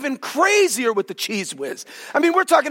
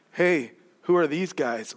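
low, soft surprised question; a hushed, puzzled aside from the newcomers; lightly voiced bewildered visitor; startled Moses and Elijah; 7 semitones lower, 5 dB quieter than usual. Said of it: "they just showed up and went".

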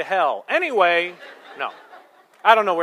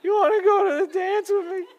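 put-on cracking teen voice; 10 semitones higher, 4 dB quieter, faster than usual. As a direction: voice leaps way up and cracks, with a laugh. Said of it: "my voice might as well crack".

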